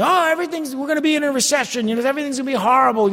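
high, fast mock doom prophet; a light parody of a panicky forecaster; mocking false prophet. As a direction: rattle off the gloom like a TV pundit. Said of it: "they're telling".

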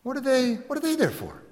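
high, wondering voice of the wife; puzzled wife. Retold or imitated. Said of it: "She goes".

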